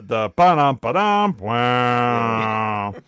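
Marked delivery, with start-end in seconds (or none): 0.0-1.4 vocal drum rimshot ba-dum; rimshot imitation. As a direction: mouth a rimshot. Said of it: "that definitely needed the".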